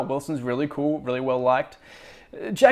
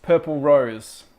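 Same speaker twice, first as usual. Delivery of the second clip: Purple Rose said slow, loud and deliberate; stress on Purple Rose; 7 dB louder, slower than usual. Shown as a demonstration. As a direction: spell out Purple Rose as a correction. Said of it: "it wouldn't be Purple Heart".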